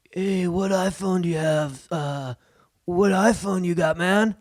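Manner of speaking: slow, laid-back stoner-bro drawl; Apple store guy. Retold or imitated. Imitating the store clerk, a slow stoner drawl. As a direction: lazily ask like a chilled-out store clerk. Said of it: "That's what they talk like".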